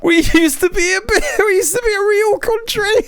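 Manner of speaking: mocking in a very high, laughing voice; laughing mockery. Real, not acted. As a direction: squeal the quote through laughter. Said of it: "mocking the woman while laughing".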